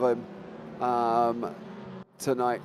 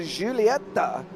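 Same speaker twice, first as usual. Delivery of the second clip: normal talking voice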